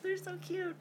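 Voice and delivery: high soft cooing; gushing. Real, not acted. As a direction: coo over how cute they are.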